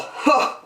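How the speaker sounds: high breathy scoffing laugh; mocking kangaroo laugh; scornful laugh. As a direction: laugh at him with contempt. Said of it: "laughed the sour kangaroo".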